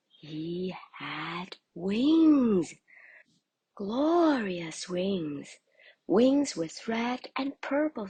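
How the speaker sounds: awed storyteller reveal; marvelling. A narrator's reading. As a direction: savour the surprise of new wings. Narrator marvels at wings.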